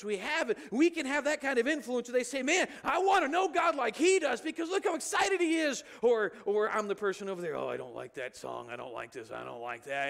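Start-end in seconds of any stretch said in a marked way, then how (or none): 2.4-5.8 high, loud, eager admirer; excited. Retold or imitated. Excited churchgoer voiced by preacher. 7.5-10.1 lower, grumbling churchgoer; grumpy complainer. Retold or imitated.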